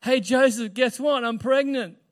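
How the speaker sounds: loud, high, blurted-out news; mock excited announcement. Woman telling her fiancé she's pregnant, mock excited announcement. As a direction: blurt it out as cheery big news, loudly.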